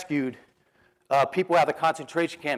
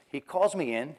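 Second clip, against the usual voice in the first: usual speaking voice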